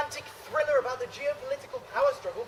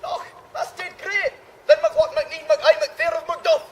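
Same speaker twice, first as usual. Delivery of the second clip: put-on loud hammy Scottish accent; 3 semitones higher, 4 dB louder than usual. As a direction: shout it in mock Scots. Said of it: "perfect Scottish accents".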